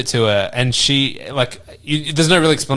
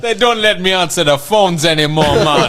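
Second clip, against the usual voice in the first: put-on Jamaican accent; 6 semitones higher, 4 dB louder than usual. Continuing the Jamaican bit.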